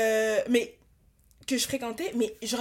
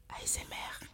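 breathy teasing whisper; 13 dB quieter than usual. Pretend. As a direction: whisper it like ASMR. Teasing ASMR vlog whisper.

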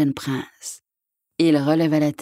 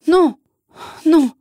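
high, loud, sharp protest; alarmed cry; 10 semitones higher, 6 dB louder than usual. A narrator's reading. Mother bear stops hunter's dagger, high loud cry. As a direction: shout out to stay the dagger. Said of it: "une voix s'écria".

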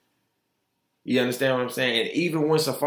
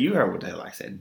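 usual speaking voice

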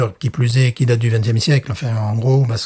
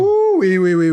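excited whoop; 5 semitones higher than usual. Real, not acted. Reacting to the prune aroma.

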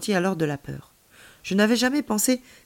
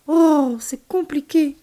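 baffled complaint; 8 semitones higher, 6 dB louder, much slower than usual. A narrator's reading. Child baffled by explanation.

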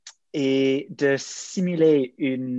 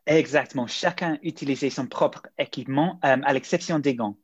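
usual speaking voice

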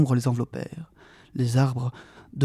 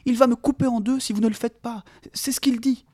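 frightened plea; 10 semitones higher than usual. A narrator's reading. Mary trapped, pleading.

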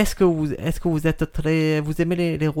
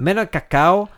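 fake Italian accent; 3 semitones higher than usual. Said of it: "tu parles très bien le faux italien".

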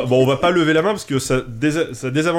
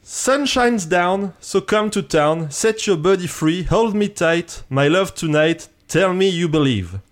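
French-accented English; slower than usual. Shown as a demonstration. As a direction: recite each phrase deliberately with a French accent. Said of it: "Avec mon accent anglais absolument impeccable". (anglais à fort accent français, récité lentement)

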